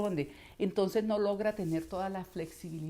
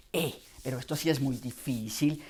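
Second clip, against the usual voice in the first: much lower and darker, larynx dropped; 6 semitones lower, 4 dB louder than usual. Shown as a demonstration. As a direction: sink the voice down as if the knees were bent. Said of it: "bajó la laringe y ya la coloca muy abajo". (voz grave con la laringe muy abajo)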